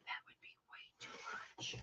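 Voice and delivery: hushed, quick whisper to the audience; whispered aside. Real, not acted. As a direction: drop to a secret whisper. Aside after "fourteen inches.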